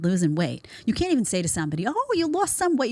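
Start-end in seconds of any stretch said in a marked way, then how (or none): 1.9-2.9 high-pitched gushing compliment, acted out; mock compliment. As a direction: imitate someone cooing over a friend's weight loss. Voices a friendly compliment.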